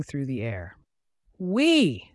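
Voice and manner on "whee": high, bright squeal of joy as she flies; gleeful exclaim. A narrator's reading. Little leaf sailing past clouds, squealing with joy. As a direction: let it fly up high and loud. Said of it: "Flora exclaimed".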